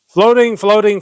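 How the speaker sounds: declaimed refrain, pushed high and loud; emphatic poem chorus. Acted. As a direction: belt out the repeated word. Recites chorus before cracking up.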